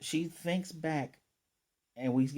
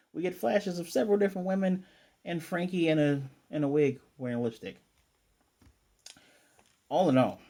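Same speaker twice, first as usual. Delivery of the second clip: ordinary delivery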